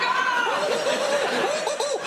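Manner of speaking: high shout as if hit by cold water; shocked shout. Pretend. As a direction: shout as if frozen.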